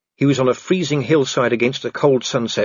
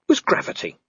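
usual speaking voice

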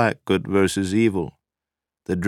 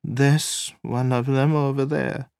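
shaky; 9 semitones higher than usual. A narrator's reading. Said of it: "Nina said shakily".

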